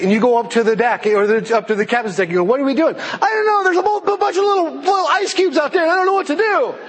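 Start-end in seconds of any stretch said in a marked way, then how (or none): 2.4-3.0 high, alarmed question; hammed-up worried passenger; mock panic. Passenger asking the captain on the Titanic, high mock panic. 3.0-6.7 very high, squealing panicked captain; hammed-up helpless wail, sky-high; mock panic. Captain facing ice cubes on the Titanic, squeaky mock panic.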